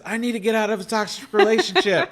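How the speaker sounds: high-pitched, hammed-up announcement; mock urgent plea. Man bursting into his support group, urgent and high.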